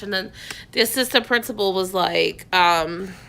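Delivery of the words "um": normal talking voice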